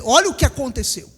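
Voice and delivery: ordinary talk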